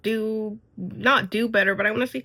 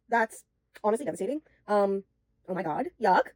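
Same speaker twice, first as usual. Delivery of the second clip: ordinary talk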